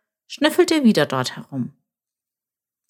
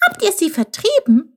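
high-pitched amazed question; puzzled wonder; 13 semitones higher, 3 dB louder than usual. A narrator's reading. Chilp asks the jackal, amazed and very high. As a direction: ask in wonder, voice leaping up. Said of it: "fragte Chilp verwundert".

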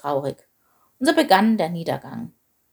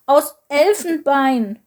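slow, higher, emphatic outrage; indignant snort; 7 semitones higher, 6 dB louder, slower than usual. A narrator's reading. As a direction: spit it out indignantly, stretched and raised. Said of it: "schnaubte Wanda empört".

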